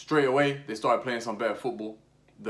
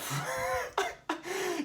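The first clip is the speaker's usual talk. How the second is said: high laugh; 6 dB quieter than usual. Real, not acted. Vlogger laughing at Arteta being angry, high cackle. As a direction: burst of high-pitched laughter.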